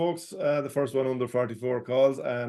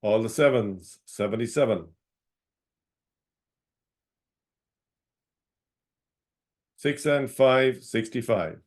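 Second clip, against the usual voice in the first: calling each number out, then repeating it; sing-song bingo number calls; bingo caller. Acted.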